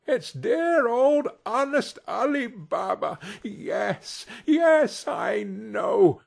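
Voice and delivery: high, overjoyed outburst; acted rapture, pitch leaping up; ecstatic exclamation. A narrator's reading. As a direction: burst out high with delighted recognition. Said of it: "Scrooge exclaimed in ecstasy".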